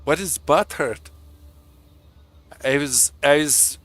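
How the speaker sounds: bad Swedish accent. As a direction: imitate a Swedish comic with a clumsy accent. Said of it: "I can't do the Swedish accent".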